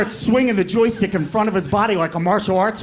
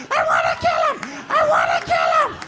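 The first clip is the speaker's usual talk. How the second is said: excited yell. Retold or imitated. Kid yelling during the choke.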